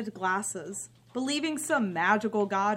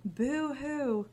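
sarcastic fake crying; mock self-pity, dry; mocking boo-hoo; 2 semitones higher than usual. Daughter mocks her own loneliness.

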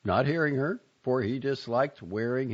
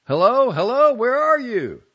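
high, loud call through the house; 12 dB louder than usual. A narrator's reading. Husband calling through the house for his wife, loud high call. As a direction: call to a wife somewhere out of sight. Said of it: "he would continue to call her".

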